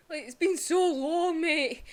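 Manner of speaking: real crying. Real, not acted. Fan tearing up to camera over a returning character, crying. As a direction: let the tears break through the words. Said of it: "Also made me cry again".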